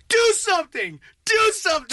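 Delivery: high, goading shout; yelling. Retold or imitated. Player challenging the man in his face, yelling. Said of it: "So I started yelling".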